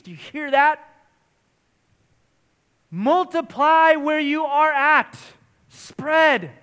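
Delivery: rousing pulpit exhortation; fervent preaching. Acted. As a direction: preach it out with full conviction. Preaching at full force.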